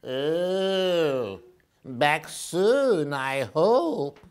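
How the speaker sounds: slow, drawn-out worried owl voice; slow middle brother owl. Acted. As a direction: stretch out goodness, fretting. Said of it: "said Percy".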